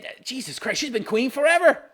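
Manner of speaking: ordinary talk, nothing marked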